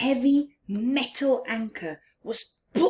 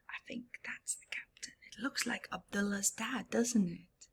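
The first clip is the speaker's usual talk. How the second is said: fast hushed aside; whispered aside; 13 dB quieter, faster than usual. Real, not acted. Reader whispering about the picture to the children.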